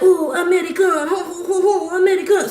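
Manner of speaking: high teasing catcall; sing-song catcall. Retold or imitated. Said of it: "So they be like".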